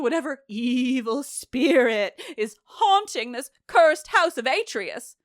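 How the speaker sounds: high, hammed-up wail; mock-dramatic invocation. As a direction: call on spirits with theatrical dread. Hammed-up mocking of chorus's invocation.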